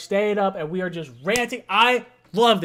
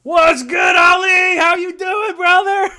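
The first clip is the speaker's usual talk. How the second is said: much higher, louder shouted hello; host shouting hello to a fellow fan caller; hyped shout greeting; 12 semitones higher, 11 dB louder, slower than usual. Real, not acted. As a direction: greet him like a long-lost friend.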